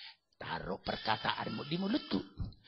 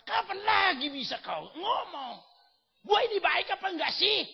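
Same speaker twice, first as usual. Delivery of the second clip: God scolding; 7 dB louder than usual. Acted. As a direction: press the believer like a demanding God. God voiced challenging believer. (Tuhan menegur dengan suara tinggi dan keras)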